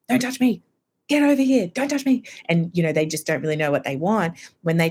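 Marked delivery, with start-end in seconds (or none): 0.1-2.2 high-pitched irritable outburst, acted; irritable labouring woman. Woman in labour warding people off, irritable and high. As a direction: act out a high, louder push-away in pain. Irritable women in labor snapping.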